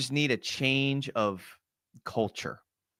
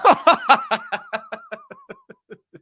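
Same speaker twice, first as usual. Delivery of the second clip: squealing high laugh, loud; high burst of laughter; 7 dB louder than usual. Real, not acted. Streamer laughing at his own joke to chat, squealing laugh. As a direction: crack up at the chat.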